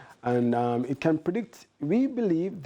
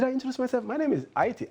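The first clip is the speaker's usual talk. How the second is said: playful; 11 semitones higher, faster than usual. Real, not acted. Playful self-introduction on air.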